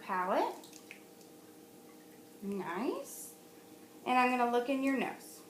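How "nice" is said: normal talking voice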